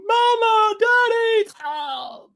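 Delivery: put-on high, loud terrified child's cry; child screaming for help. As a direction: scream for your parents in terror. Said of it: "they're screaming".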